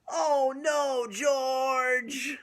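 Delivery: high, loud mock alarm. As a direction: cry it out to the kids. Reacting to page.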